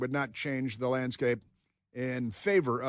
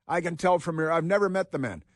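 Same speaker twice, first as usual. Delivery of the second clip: ordinary talk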